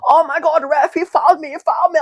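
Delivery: put-on high, loud whiny German accent; hammed-up German accent, high and loud; mock German accent. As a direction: squeal it like a moaning German player. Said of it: "Is that your, your attempt at a German accent?".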